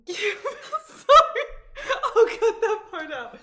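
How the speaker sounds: high, loud laughing apology; breaking into laughter. Real, not acted. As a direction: apologise while cracking up. Breaks up asking guest question.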